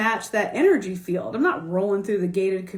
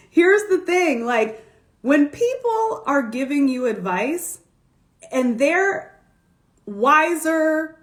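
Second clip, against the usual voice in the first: louder, higher and emphatic; emphatic advice; 5 semitones higher, 3 dB louder than usual. Real, not acted. To viewers, emphatic advice. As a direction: drive home the lesson with energy.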